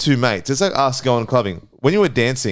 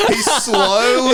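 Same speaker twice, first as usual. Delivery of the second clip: laughing; 11 semitones higher, slower than usual. Real, not acted. Laughing at the creeping guy.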